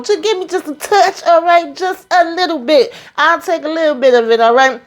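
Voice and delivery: high, wheedling beg for just a little; pleading neighbor. Retold or imitated. Neighbor begging him for a small cut, high wheedling voice.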